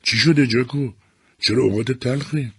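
wife's voice. A narrator's reading. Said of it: "صدای سیمونا رو شنید".

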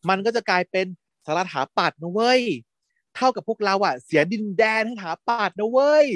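persuasive appeal. Retold or imitated. Student persuading his friends to defend the pavilion, persuasive appeal. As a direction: work the friends up, pitch climbing at the end. Retold speech rousing friends to act.

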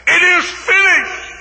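high, loud and slow; triumphant cry. Acted. Jesus on the cross, crying out slow and loud. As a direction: ring it out in triumph. Jesus's dying words repeated.

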